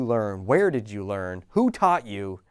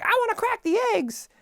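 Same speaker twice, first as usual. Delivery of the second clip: put-on high, squeaky child voice asking to help; very high small-child voice, eager; eager little kid. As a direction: play the kid begging for a turn. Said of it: "the kid's like".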